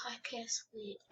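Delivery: breathy whispered complaint. Acted. Maid alone at dawn, whispering. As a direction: whisper it to yourself.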